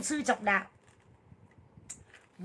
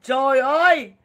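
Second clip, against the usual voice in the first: exasperated cry; 2 semitones higher, 7 dB louder, slower than usual. Real, not acted. Exclaiming about her narrow escape.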